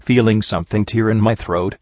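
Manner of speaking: ordinary delivery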